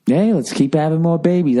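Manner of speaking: high-pitched mock enthusiasm; mock cheerleading. Comic teasing Right to Life benefit crowd, mock cheerleading. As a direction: pitch it way up as a teasing rallying call.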